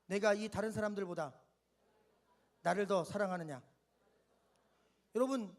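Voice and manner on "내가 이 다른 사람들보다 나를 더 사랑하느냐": low, gentle voice of Jesus asking; voicing Jesus. Shown as a demonstration. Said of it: "한번 따라합시다".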